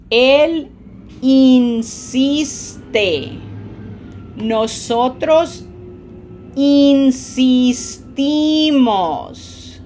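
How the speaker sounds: loud, very slow drill, "insistes", "insiste", "insisten" in stretched syllables; repeat-after-me model. Shown as a demonstration. Teacher drilling verb forms for repetition, slow, loud "insisten". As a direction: push air into the stressed syllable of "insistimos", every I a smiled ee. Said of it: "Repeat after me".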